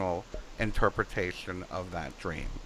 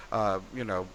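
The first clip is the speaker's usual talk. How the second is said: normal talking voice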